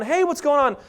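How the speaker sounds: high, bright mock hello; friendly phone greeting. Friend answering the phone with a high mock greeting.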